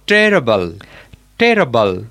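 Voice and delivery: "terrible" as TE-ruh-bul, middle vowel reduced; corrective pronunciation. Shown as a demonstration. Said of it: "Wait, Rian".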